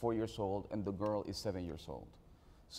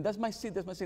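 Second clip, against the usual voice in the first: imitating a little girl, much higher, insistent; stronger child protest; 10 semitones higher, faster than usual. Niece protests harder to the fifteen-year-old, higher insistent child voice. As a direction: pitch it up like a kid demanding her seat back. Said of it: "protest a little bit stronger".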